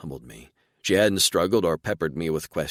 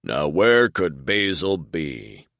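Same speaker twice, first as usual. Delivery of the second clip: slow, louder call, a teasing seek-and-find voice; calling out playfully; 5 dB louder, slower than usual. A narrator's reading. Alien father calling out to his hiding daughter, amused. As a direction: draw it out like hunting for a hidden child. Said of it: "he called out".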